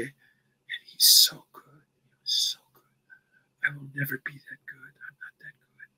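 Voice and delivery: imitating the wife in a slow breathy whisper; quoting the wife who doubts her husband, hushed and slow. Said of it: "His wife goes".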